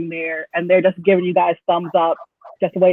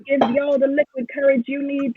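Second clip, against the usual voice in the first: lightly voiced halfling, a bit higher; a hint of a scheming halfling making a pitch; halfling recruiter; 6 semitones higher than usual. Game master plays halfling hiring party.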